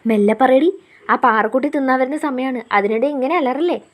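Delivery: ordinary delivery, nothing marked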